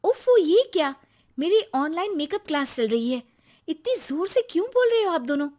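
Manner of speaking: high, quick scolding; irritated complaint. Acted. Wife whose online makeup class is disturbed, irritated. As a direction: complain in a rush, pitched high.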